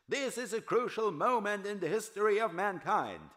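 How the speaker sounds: high, loud yell over a crowd; yelling chairman. Acted. Chairman yelling over a restless mob, high and loud. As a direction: shout it over the noise of the hall. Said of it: "Gerald Starnes yelled through the noise".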